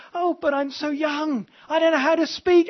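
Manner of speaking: put-on high-pitched, protesting young prophet; Jeremiah. Reluctant prophet telling God he's too young, high protest. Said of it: "Jeremiah says".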